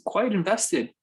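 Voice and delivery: "quite" jumps up high and falls; lifting the phrase to underline it; high emphatic lift. Shown as a demonstration. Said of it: "first take, lifted high".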